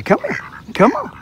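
very high-pitched call to ducks; coaxing animals. Real, not acted. Fisherman beckons the ducks, high coaxing call. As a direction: coax them closer way up high.

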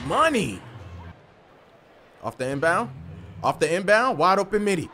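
ordinary talk, nothing marked

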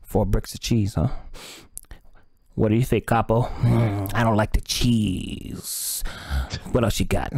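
low, loud mob boss mulling it over; a Mexican cartel leech, unimpressed; low gangster drawl, turning down the offer. Acted.

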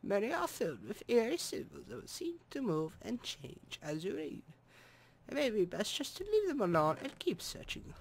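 hammed-up German accent reading. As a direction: read the eerie book like a German narrator. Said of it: "So yes, I can be German".

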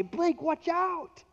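high, urgent mock warning call; imitating wife's warning. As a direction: play the caring wife calling out. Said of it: "I'm gonna jump off my bike and say".

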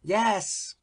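put-on high, eager children's voice; crying out. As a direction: cheer it like a pack of children. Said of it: "cried all the children".

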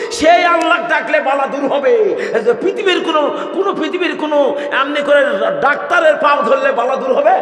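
fiery preaching. Acted. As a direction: preach it full force to the crowd. Preaching at full force.

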